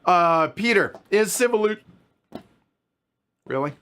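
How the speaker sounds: hiccups cutting in; talking through hiccups. Real, not acted. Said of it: "I got the hiccups now".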